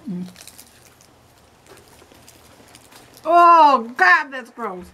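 chewing while talking in disgust; talking around food; mouth full of seaweed. Real, not acted. Seen 0: Woman puts seaweed in mouth at -4, grimaces chewing, then turns away.

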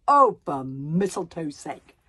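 loud, slow groaning exclamation; exasperated. Acted. Reacting to the danger on the map, exasperated. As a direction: let out weary dismay.

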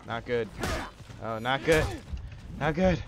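from muttered worry to loud panic; building panic. Real, not acted. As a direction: let the alarm rise each time. Repeats worry as losing.

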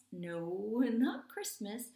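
plain reading voice

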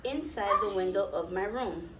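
plain reading voice

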